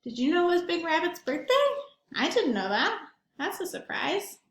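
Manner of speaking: plain reading voice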